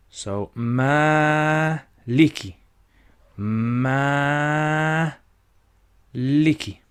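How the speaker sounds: "ma" held long, then "liki" short; stretched ma. Shown as a demonstration. Teacher showing the long ma to students. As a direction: stretch the "ma" well out before "liki". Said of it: "So let's stretch it then".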